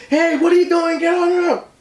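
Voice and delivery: high-pitched frantic imitation of grandma; going crazy. Grandma confronting the intruder, imitated high frantic yelling. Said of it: "you know, like, going crazy".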